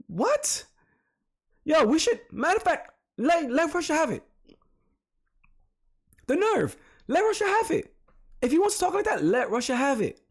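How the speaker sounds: high, exasperated outrage at the guest's nerve; indignant outburst. Real, not acted. Vlogger fumes at the guest, high and outraged. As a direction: voice jumps up in disbelief. Outraged reaction to perceived threat.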